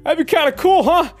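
clueless mouth breather. Retold or imitated. Clueless roommate answering a passive-aggressive hint, high and dopey. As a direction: play the oblivious goof who misses the hint. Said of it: "mouth breathers like me".

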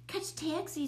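mild scream, pitched well up; high urgent call, only lightly screamed. A narrator's reading. Brian orders his neighbor to catch the cat, mild scream. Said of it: "screamed Brian".

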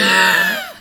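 sudden squealing laugh; burst of laughter. Real, not acted. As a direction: crack up in a high-pitched burst.

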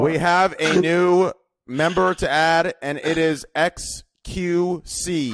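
loud, drawn-out big reveal; announcer introduction. Pretend. Host unveiling a new member, announcer style. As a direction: announce the guest slowly and loudly.

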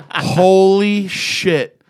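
slow drawn-out amazement; awed holy shit. Retold or imitated. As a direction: stretch it out in awe. Awed reaction to hero.

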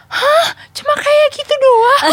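high, loud shriek of disbelief, slowing on the question; teriakan kaget. Retold or imitated. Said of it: "Kita udah teriak". (teriak kaget tak percaya, melengking)

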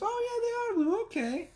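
very high mock squeal of relief; satisfied mom. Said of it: "She was".

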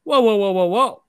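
put-on stopping the girls pouring his vodka; mock alarm. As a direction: halt them with a startled whoa. Stopping girls making drinks.

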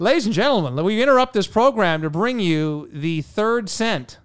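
broadcast-style mock bulletin; old radio announcer. Mock news bulletin intro.

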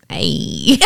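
low, hammed-up sexy voice; a low awkward sexy hey; sultry narrator. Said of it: "Say that in a sexy voice".

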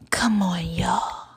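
soft whispered come-on to listeners; whispered tease. Pretend. Coaxing podcast fans along in a whisper. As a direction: tease them in a hush.

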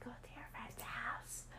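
unvoiced inner voice; whisper. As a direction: whisper it like a voice in your head. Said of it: "the voice came in my head".

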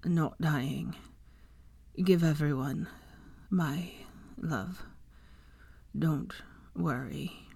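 drowsy, faint, broken into halting fragments; drifting off mid-sentence, fading to almost nothing; weak, halting words with long pauses; speaking with difficulty. A narrator's reading. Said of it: "he said with difficulty as sleep started".

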